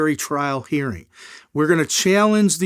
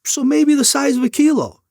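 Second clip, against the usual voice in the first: leading prosecutor; 11 semitones higher, 5 dB louder than usual. Retold or imitated. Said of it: "he goes".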